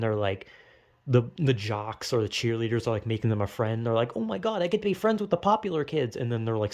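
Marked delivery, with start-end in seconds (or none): 4.2-6.2 fast, high, breathless delight at being picked; gushing teen. Retold or imitated. Lonely teen thrilled the popular kids befriended them, fast excited gush. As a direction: squeal it like a lonely teen just invited in.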